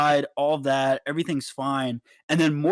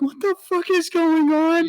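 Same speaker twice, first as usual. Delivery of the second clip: high, loud retold disbelief; imitating his own shocked reaction; mock bewildered outburst; 13 semitones higher, 4 dB louder than usual. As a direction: throw up your hands in disbelief. Bouncer baffled by sudden threats.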